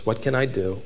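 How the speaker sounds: low, soft, helpless question; pitiful. Shown as a demonstration. Preacher showing the father's inflection, pitiful. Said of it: "think of the pitiful inflection in his voice".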